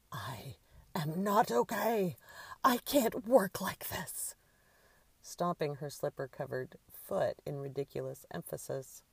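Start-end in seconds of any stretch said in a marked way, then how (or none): 0.1-4.3 high, slow, indignant shout; shouting through a mask. A narrator's reading. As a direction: wail it loudly in a drawn-out tantrum. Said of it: "Bobby's voice shouted through the crow mask".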